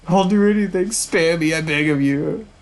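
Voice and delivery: high, frantic plea; panicked bishop pleading for mercy; terrified begging. Acted. Bishop begs for his life.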